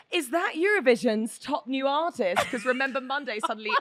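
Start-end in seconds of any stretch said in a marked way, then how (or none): 0.1-2.4 loud, slow, high mock yell; imitated scream. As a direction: call it out like an excited screamer. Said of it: "suddenly [name] just screams".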